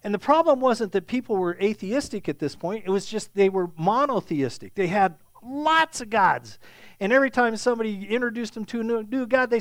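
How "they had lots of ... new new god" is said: ordinary talk, nothing marked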